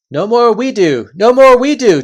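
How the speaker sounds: loud, high chanting of agreement; eager chorus voices. Acted. Followers backing their chief, loud and eager. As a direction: cheer him on as a crowd of followers.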